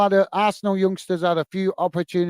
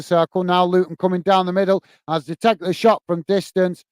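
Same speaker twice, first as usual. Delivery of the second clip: live play-by-play; 2 semitones lower than usual. Acted. Streamer calling the Luton Arsenal game for viewers, play-by-play. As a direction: call the match as it unfolds.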